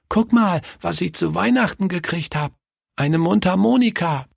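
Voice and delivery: put-on high, proud child showing off a gift; excited child. A narrator's reading. Willi shows his friend his Christmas present, excited child.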